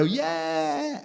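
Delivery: put-on high, drawn-out child's yeah; high-pitched mock kiddie cheer; excited little kids. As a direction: answer like a delighted small child. Young children cheer the robot.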